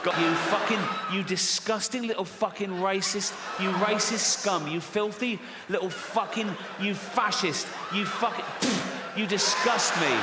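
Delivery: hammed-up indignant yelling; mock outrage. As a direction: rant at them in fake disgust. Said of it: "mock outrage at audience".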